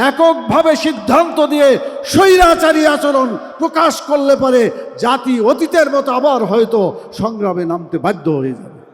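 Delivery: loud, high-pitched rally oratory; full-force political shout from the podium; shouted warning. Real, not acted. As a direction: thunder a warning at the rulers, easing toward the end. Rally warning against autocratic rule.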